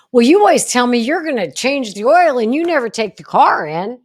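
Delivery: mock accusing partner. Partner hitting back about the oil change, loud and accusing. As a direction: throw the blame back like a defensive partner.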